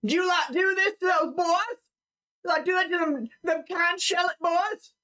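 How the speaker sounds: high-pitched, outraged townsperson; angry accuser. Acted. Townsperson accuses strangers of killing boys, high outraged voice. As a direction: accuse as one of a mob.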